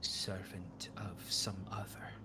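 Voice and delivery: a cryptic oracle studying a stranger; a hint of an otherworldly prophetess; eerie seer woman.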